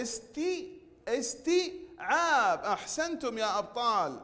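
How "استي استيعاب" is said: استيعاب broken after a long tii, higher pitched; very slow استي, pause, then a long عاب; saturated long ii. Shown as a demonstration. Teacher repeating the taa for pupils, syllables drawn out. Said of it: "ممدود بالياء".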